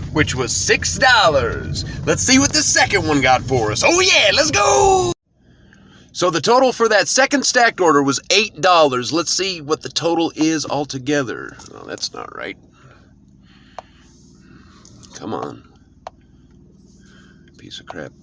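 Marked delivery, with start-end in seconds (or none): 3.9-5.1 high, thrilled whoop; excited shout. Real, not acted. Celebrating second order pay. 11.8-18.1 low, soft grumble under the breath; muttered frustration. Real, not acted. App total glitches.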